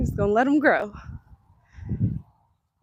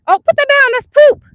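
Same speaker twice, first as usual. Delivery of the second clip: high, louder startled warning; sudden loud parent warning; alarmed; 10 dB louder than usual. Real, not acted. Child picks up deer poop.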